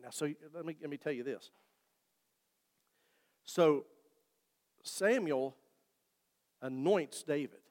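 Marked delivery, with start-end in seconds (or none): none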